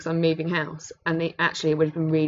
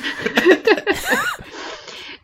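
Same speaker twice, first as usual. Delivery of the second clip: burst of laughter; 5 dB louder than usual. Real, not acted. Laughing at the joke.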